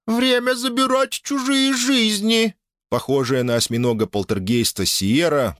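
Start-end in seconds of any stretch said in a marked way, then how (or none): 0.1-2.5 high, slow ghostly cry; eerie poltergeist. Acted. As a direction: wail it like a hungry spirit. Poltergeist Sierra cries out. (призрачный вой)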